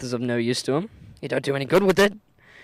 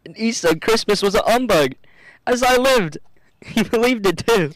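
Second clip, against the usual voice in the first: loud, high, laughing through the words; laughing merrily; 8 semitones higher, 6 dB louder than usual. Acted. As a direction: tell it while cracking up. Fred laughing at party.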